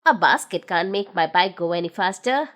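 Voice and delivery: put-on low, older gnome voice; a low, fond old friend; Big Ears thanking Noddy. A narrator's reading.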